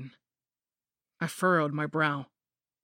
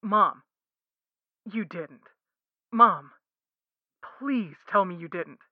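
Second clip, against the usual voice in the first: pleading husband; 2 semitones higher, 4 dB louder, slower than usual. Acted. Husband pleads with his mother.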